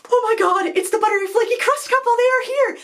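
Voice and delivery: very high excited squeal; freaked out. Retold or imitated. Mom spots local celebrities and tells her girls, freaked out. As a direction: squeal in starstruck disbelief. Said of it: "freaked out".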